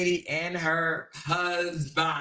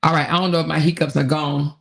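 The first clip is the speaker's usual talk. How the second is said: usual speaking voice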